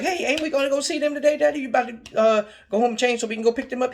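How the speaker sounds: put-on a hopeful kid asking dad; eager child. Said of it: "I'm like".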